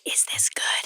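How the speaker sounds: hushed, furtive whisper; whispered check. Acted. Actor checking herself during a take, whispered. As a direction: whisper it as a question.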